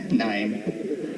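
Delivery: ordinary talk, nothing marked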